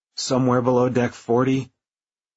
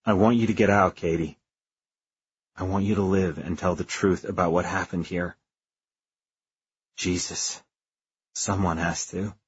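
low, soft, resigned goodbye; resigned farewell; 5 semitones lower, 5 dB quieter than usual. Acted. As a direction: let go quietly, heavy-hearted. Farewell asking her to leave.

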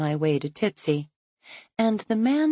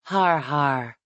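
sour; 2 semitones lower than usual. A narrator's reading. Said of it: "His voice sours".

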